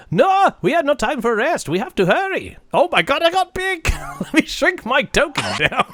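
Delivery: high excited hurried voice; squeaky little leprechaun urging everyone on. Retold or imitated. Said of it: "he's like".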